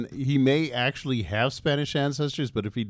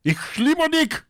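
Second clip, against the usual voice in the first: I love you in German, much higher and barked like a German accent; harsh German; 4 dB louder, slower than usual. Shown as a demonstration. Showing hosts how to say I love you, in harsh German. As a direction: demonstrate the German, sounding almost hostile. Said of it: "It sounds like you're telling them you hate them".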